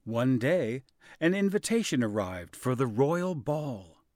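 plain reading voice

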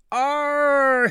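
high, loud pained scream; wolf scream. A narrator's reading. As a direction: yelp as if scalded. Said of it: "he screamed".

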